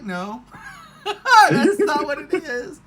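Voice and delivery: slow laughing "no"; laughing retort. Retold or imitated. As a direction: laugh out the denial. Retelling the movie's answer, laughing.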